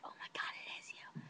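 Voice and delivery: very soft, stunned whisper; awed whisper. Retold or imitated. Realizing the boy really messaged her, awed whisper.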